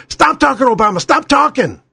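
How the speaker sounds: high, emphatic mock moderator's order; Keith Olbermann. Mimics moderator silencing Obama.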